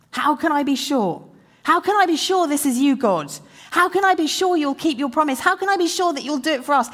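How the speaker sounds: a desperate man begging God for proof; anguished cry. Retold or imitated. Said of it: "the real cry of his heart".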